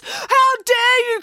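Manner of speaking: high, loud, drawn-out indignation; outraged cry. A narrator's reading. April sees her dog kicked, outraged cry. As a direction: yell it in fury. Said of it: "cried April".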